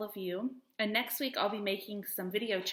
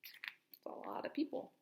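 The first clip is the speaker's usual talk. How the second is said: breathy whispered comment; soft whisper to the class; whispered aside; 6 semitones higher, 10 dB quieter, slower than usual. Real, not acted. Aside whispered during story.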